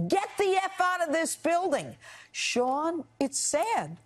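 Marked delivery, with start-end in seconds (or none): 0.1-1.9 pitched-up imitation of a yell; retold yell. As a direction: echo her shouting at you, pitched up. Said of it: "she's yelling at me".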